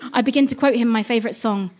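ordinary delivery

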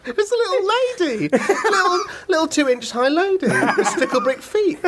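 high, laughing through the words. Real, not acted. Storyteller cracking up at his own punchline, laughing. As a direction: barely get it out for laughing.

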